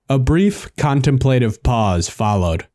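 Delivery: ordinary delivery, nothing marked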